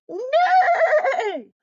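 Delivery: loud, high-pitched neigh; horse whinny. A narrator's reading. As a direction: imitate a rearing horse's long whinny. Horse rears in the story.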